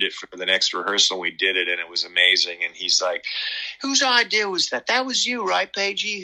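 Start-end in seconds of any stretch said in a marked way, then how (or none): 3.8-6.2 much higher, put-on [name] voice; [name] imitation. [name] crediting his guitarist for the song, high imitated voice. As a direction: play [name] teasing in a high, mock-innocent voice. Quoting [name] after rehearsal.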